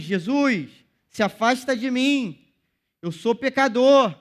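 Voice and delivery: a preacher acting out Peter's anguished cry; Peter's plea. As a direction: voice Peter begging Jesus to leave him. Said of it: "Como Pedro falou pra Jesus".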